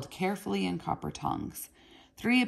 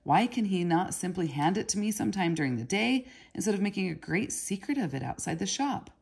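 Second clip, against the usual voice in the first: usual speaking voice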